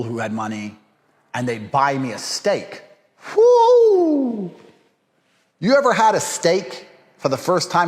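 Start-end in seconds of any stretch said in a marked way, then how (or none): 3.3-4.5 long high loud "woo!"; excited whoop. Real, not acted. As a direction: let out a drawn-out, piercing whoop of joy. Delight at being bought steak.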